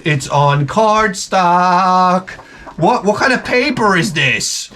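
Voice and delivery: mock awe. Real, not acted.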